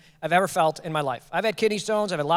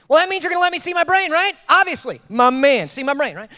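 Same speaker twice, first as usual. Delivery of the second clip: loud, high-pitched eager outburst; acted-out thrilled reply; excited; 8 semitones higher, 7 dB louder than usual. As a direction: pipe up with giddy confidence. Acts out his excited reply.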